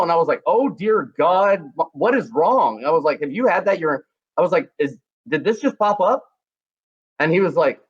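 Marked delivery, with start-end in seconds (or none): 0.5-2.8 high, slow, aghast; horrified shock. Retold or imitated. Shocked at strange medical sight. 3.2-6.2 higher, rushed worried questions; pitched-up panic; alarmed questioning. Retold or imitated.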